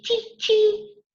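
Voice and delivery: high squeaky chee chee; mock cricket chirp. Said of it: "a cricket goes chee".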